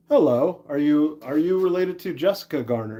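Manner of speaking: ordinary talk, nothing marked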